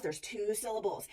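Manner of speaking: usual speaking voice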